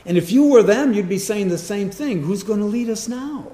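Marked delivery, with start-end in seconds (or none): 2.2-3.5 lightly acted anxious follower, higher pitch; a touch of pretend panic; mock worried. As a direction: voice a worried crowd's question, pitched up. Voicing worried Israelites after Moses.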